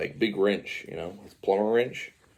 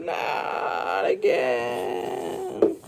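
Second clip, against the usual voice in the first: long drawn-out cartoon cry; mock falling wail; much slower than usual. Yoshi falling into the depths, long drawn-out wail. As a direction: stretch every vowel as if falling away.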